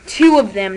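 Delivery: "Two" punched loud; stress on two. Shown as a demonstration. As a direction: hit the word "Two" hard. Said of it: "not just one".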